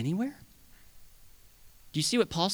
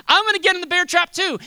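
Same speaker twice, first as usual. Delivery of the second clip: much higher and louder, eager; eager resolve; 14 semitones higher, 8 dB louder than usual. Pretend. Church member eagerly volunteering to share the burden, higher and louder. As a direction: declare it boldly. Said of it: "It's saying".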